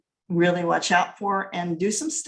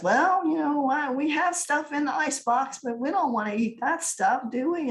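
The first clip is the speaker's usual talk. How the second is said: higher, lightly voiced grandma coaxing; grandma's voice; 7 semitones higher than usual. Grandma nudging the family toward the diner, higher voice. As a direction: play the grandma hinting they should go out. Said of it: "and grandma says".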